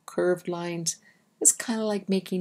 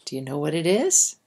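ordinary delivery

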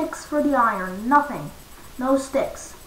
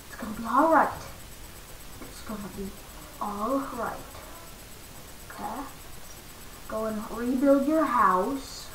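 usual speaking voice

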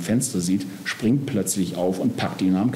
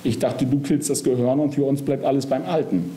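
mildly indignant, higher-pitched objection; 6 semitones higher than usual. A narrator's reading. Body objecting to Death's plan, indignant. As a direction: protest as if misled. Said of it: "empört sich der Körper".

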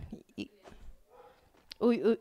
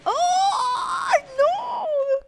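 long, very high wailed cry; loud shrieked reaction of disbelief; anguished shout; 4 dB louder than usual. Real, not acted. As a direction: scream in dismay, drawing it out. Player eliminated.

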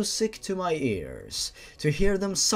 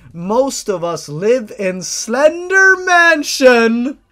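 booming voice; 7 semitones higher, 12 dB louder, slower than usual. A narrator's reading. Ominous announcement.

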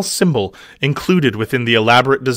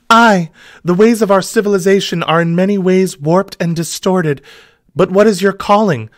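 high, breathy-free damsel voice; 7 semitones higher, 4 dB louder than usual. Acted. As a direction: voice the heroine higher.

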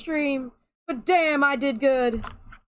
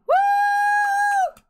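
victory whoop. Real, not acted. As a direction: whoop like you just won. Victory cheer after the win.

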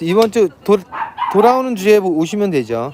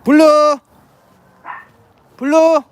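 high, loud call across the room; 8 semitones higher, 4 dB louder than usual. Real, not acted. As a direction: shout the dog's name to fetch it. Calling the dog loudly. (강아지를 크게 부르는 소리)

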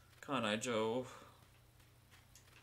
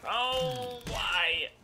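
loud high anguished wail; anguished cry; 10 semitones higher, 6 dB louder than usual. Real, not acted. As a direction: wail it in despair. Despair in game.

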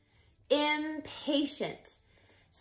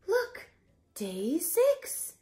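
ordinary delivery, nothing marked

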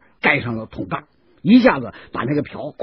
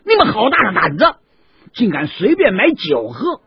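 stern scolding; 7 semitones higher, 4 dB louder than usual. Acted. 杨志举刀背打士兵，厉声呵斥他们. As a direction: scold them for daring to drink. Yang Zhi scolds soldiers.

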